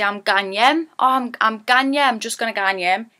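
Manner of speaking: gan yem in broad Geordie. Shown as a demonstration. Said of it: "we'd say".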